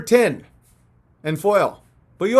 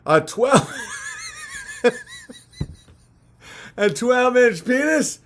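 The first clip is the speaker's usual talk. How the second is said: mocking media voice; 6 semitones higher than usual. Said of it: "mainstream media ... said".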